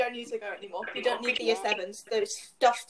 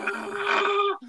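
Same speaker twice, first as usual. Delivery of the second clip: high, groaning ugh of despair; exasperated groan; 9 semitones higher, 4 dB louder than usual. Retold or imitated. Teen sighing at exam stress, groaning ugh. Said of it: "we're just like".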